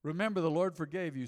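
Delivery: measured preacher reading; scripture reading. Acted. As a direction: read the verse with weight.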